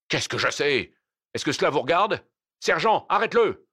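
irritated challenge rising to a shout; indignant, building to a high command; angry retort. Acted. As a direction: brush him off, then shout the order. Brigadier snaps back, orders arrest.

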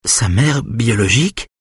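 a touch of crying in the question; fragile, tearful. A narrator's reading. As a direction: ask in fragile disbelief, pitch lifted. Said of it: "demande d'une voix fragilisée par les larmes".

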